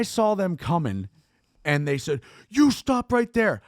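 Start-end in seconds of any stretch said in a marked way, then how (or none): 2.5-3.6 higher, drawn-out command; police order. Retold or imitated. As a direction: imitate a cop calling out an order. Police ordering him to stop.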